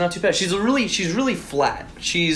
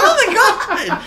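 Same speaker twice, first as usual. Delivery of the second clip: very high, loud mock shriek; imitated shocked mom; 4 dB louder, faster than usual. Son retelling mom learning about the toy, mock shocked shriek.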